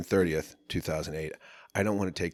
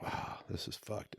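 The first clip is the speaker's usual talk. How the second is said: whispered despair; 13 dB quieter than usual. Retold or imitated. Despairing inner thought.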